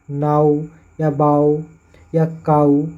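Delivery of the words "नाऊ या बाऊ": नाऊ या बाऊ spoken slowly, stretching the 'aau'; slow pronunciation demo. Shown as a demonstration. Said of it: "इसके उच्चारण में आऊ आ रहा है". (धीमे उच्चारण से नाऊ बाऊ)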